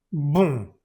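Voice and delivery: bum with a nasal vowel, no N; bum, nasal, N not spoken; nasal bon, no N. Shown as a demonstration. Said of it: "No pronuncias esa N".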